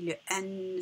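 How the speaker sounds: ordinary delivery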